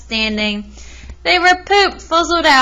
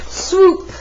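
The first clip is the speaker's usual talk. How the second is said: high swooping whoosh sound effect; 6 semitones higher than usual. Acted. Reader to child, a swooping eagle sound. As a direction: make the eagle dive sound.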